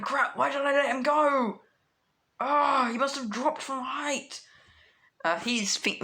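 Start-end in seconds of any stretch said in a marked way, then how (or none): 0.0-4.4 higher, exasperated self-blame; self-reproaching inner thought. Acted. Deku blaming himself for losing the villain, dismayed. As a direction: groan at your own mistake, pitched up. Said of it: "thinking this in his head".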